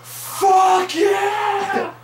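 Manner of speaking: very high, loud triumphant shout; PewDiePie victory yell. Retold or imitated. Retelling a gamer's win to a friend, triumphant shout. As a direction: scream it like finally winning. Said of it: "por fin gana y va a ser".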